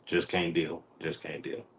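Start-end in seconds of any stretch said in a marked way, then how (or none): 1.0-1.7 whispering. Acted. Whispered repeat of own line.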